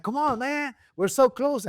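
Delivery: high-pitched imitation of a pleading car owner; eager urging. Car owner pushing the tuner for two hundred, high excited plea.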